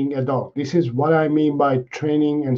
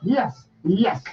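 high, excited dog praise; squeaky praising a dog; 8 semitones higher, slower than usual. Real, not acted. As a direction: gush over the good dog. Praising dog after thirty seconds.

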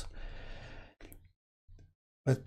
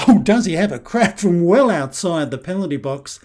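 louder, building thrill as the shot flies; excited commentary; 3 semitones higher, 6 dB louder than usual. Real, not acted. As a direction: call the long-range strike with real excitement. Long-range shot, goal coming.

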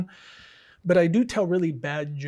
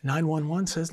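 usual speaking voice

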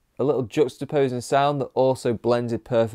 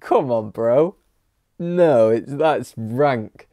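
disgusted; 4 dB louder than usual. Real, not acted. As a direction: recoil in real disgust. Disgusted at rapper licking cash.

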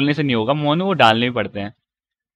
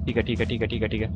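muttering; 8 semitones lower, 12 dB quieter, faster than usual. Real, not acted. Muttering to himself.

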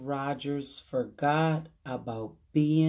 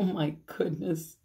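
plain reading voice

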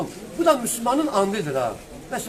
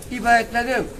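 ordinary talk

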